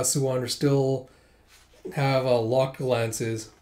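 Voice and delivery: usual speaking voice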